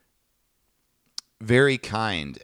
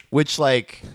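normal talking voice

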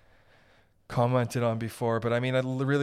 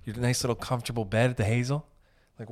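ordinary delivery

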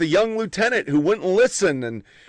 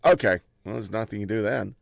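much lower, much softer, resigned commander voice; imitating the commander; 9 semitones lower, 6 dB quieter than usual. Veteran quoting the commander relieving the lieutenant, low and resigned. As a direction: drop deep and quiet like a weary superior. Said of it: "and he goes".

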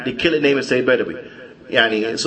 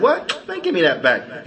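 a sharp jump up in pitch on "What?", then a blunt demand; shocked outburst; 6 semitones higher than usual. Retold or imitated. Egyptian brother hears the beggar thank Sayyid Badawi, shocked outburst. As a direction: snap out in disbelief, then demand it back.

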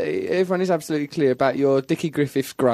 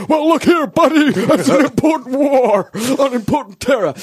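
mock blustering patriot; 7 semitones higher, 4 dB louder than usual. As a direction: ham up a pompous warmonger. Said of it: "he came to my stand-up show".

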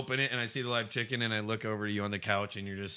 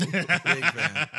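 louder, laughing big fan; 6 semitones higher, 8 dB louder than usual. Real, not acted. Repeat with laugh.

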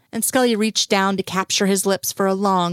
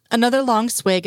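plain reading voice

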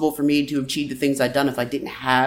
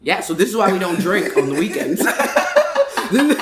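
laughing; 4 semitones higher than usual. Real, not acted. Joking after the spill.

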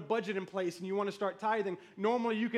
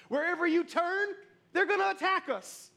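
high-pitched, insistent warning; alarmed warning; 8 semitones higher, 3 dB louder than usual. Acted. Neighbours telling the builders they will be attacked, alarmed.

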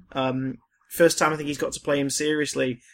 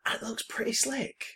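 whispering; 5 semitones higher, 10 dB quieter than usual. Real, not acted. Whispered aside.

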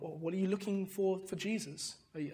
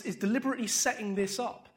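usual speaking voice

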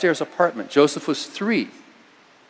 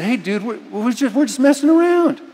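put-on startled teenager; 7 semitones higher than usual. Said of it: "they said".

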